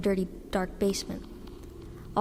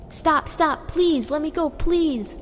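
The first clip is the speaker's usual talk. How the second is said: high, loud, pleading scream; screaming in horror; 7 semitones higher, 5 dB louder, much slower than usual. A narrator's reading. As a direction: beg in terror, higher and louder. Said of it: "Aiden screams in horror".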